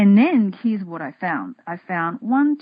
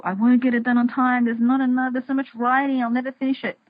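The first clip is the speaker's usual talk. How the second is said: a higher, fretful worried voice; anxious inner voice; 6 semitones higher than usual. Retold or imitated. Voicing her inner critic, higher and fretful. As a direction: let the doubt spill out, higher. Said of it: "the inner little voice".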